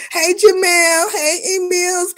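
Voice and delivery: high, slow sing-song hello; excited greeting. Real, not acted. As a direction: stretch out a cheery hello. Greeting chat viewers by name.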